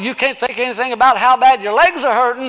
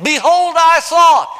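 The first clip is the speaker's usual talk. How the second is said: loud proclamation; 7 semitones higher, 5 dB louder, slower than usual. Acted. Repeating the text.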